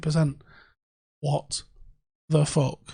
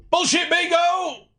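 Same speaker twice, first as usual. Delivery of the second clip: higher, slow announcer-style shout; 12 semitones higher, slower than usual. Real, not acted. Announcing his segment to viewers with a loud high shout. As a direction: bellow the segment name like a game show. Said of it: "Can't s-, shout too loud".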